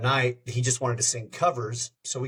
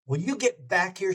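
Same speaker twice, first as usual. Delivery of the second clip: mock yell; 7 semitones higher than usual. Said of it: "finally she just yells".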